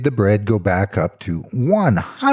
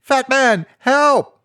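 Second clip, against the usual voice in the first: high, loud panicked cry; desperate scream for help; 4 dB louder, slower than usual. Acted. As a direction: shriek it out in terror. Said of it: "He screamed".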